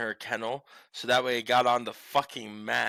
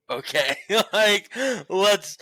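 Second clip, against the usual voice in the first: laughing; 9 semitones higher, 6 dB louder, slower than usual. Real, not acted. Laughing aside.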